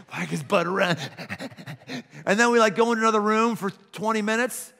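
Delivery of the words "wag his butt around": excited dog. Retold or imitated. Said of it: "the dog goes".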